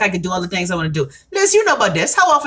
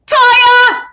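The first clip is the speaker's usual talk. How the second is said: sudden, much higher, much louder call of her name; excited shout; 8 dB louder than usual. Real, not acted. Livestream host spots viewer Toya, excited shout. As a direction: yell her name out in delight on seeing her. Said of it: "I get excited when I see Toya".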